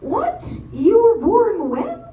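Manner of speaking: high, slow, stunned disbelief; shocked squeal. Retold or imitated. Reading the guys' passports, squealing in disbelief.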